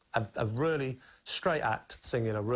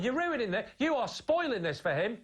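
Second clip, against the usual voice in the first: higher-pitched mock complaint; imitated indignant audience; 8 semitones higher than usual. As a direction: imitate an audience member telling someone off. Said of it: "people in the audience going".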